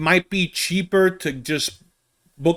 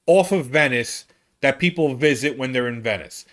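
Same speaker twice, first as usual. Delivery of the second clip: ordinary delivery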